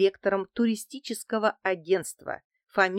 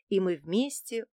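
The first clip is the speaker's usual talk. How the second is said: ordinary delivery, nothing marked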